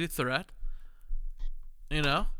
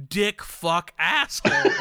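mock insult; 5 semitones higher, 7 dB louder, slower than usual. Mocks rude listener.

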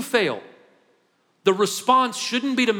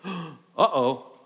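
mock gasp. Realizing own sin, comic gasp.

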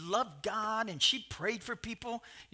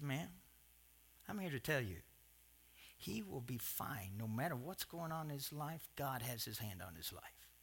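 calm reassurance; 7 semitones lower, 12 dB quieter than usual. Retold or imitated. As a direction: hush down and comfort a worried mother. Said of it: "he just stopped her and said".

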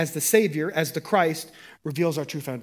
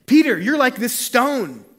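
proclaiming; 8 semitones higher, 8 dB louder, slower than usual. Acted. Jesus proclaiming to Peter.

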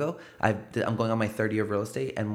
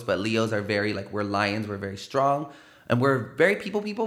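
normal talking voice

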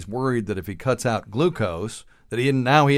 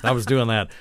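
normal talking voice

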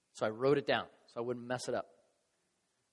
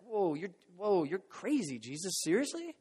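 acted incredulous disciple, higher in pitch; mock disbelief; 5 semitones higher than usual. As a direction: play a stunned objection to Jesus. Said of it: "from those outside and those inside".

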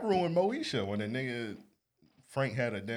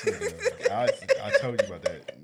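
laughing; 6 dB louder than usual. Real, not acted. Laughing accusation.

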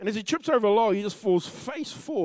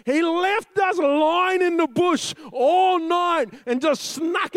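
louder, higher, driving sermon voice; preaching at full force; 7 semitones higher, 5 dB louder than usual. Acted. Preacher to congregation, building fervent sermon delivery. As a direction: hammer the point home to the congregation.

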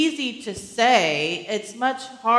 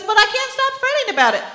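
much higher, louder, rushed whine; whiny woman; 14 semitones higher, 6 dB louder, much faster than usual. Retold or imitated. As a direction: wail it out like a frantic client. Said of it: "then she says".

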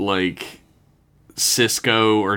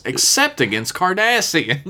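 sarcastic; 8 semitones higher than usual. Pretend. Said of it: "I'm not racist".

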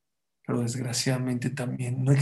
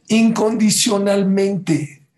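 "Incondicionalmente" drawn out, high and loud; emphatic repeat; 6 semitones higher, 6 dB louder, much slower than usual. Real, not acted. Preacher drives home God's love, "Incondicionalmente" loud and stretched. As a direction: slowly proclaim every syllable of "Incondicionalmente". Repeats key word with force.

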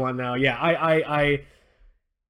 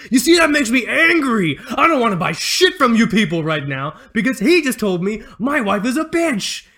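mock shout; 6 semitones higher, 5 dB louder than usual. Boss outburst, retold.

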